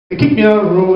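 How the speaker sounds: ordinary delivery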